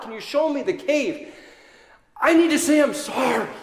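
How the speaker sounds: high, loud desperate pleading; grief-stricken plea. Acted. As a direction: beg through grief for the body. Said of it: "She's beside herself with grief".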